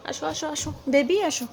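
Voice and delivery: softly repeated come here; soft coaxing call. Real, not acted. Mother softly calls her sulking son to come. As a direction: hush it out gently, luring.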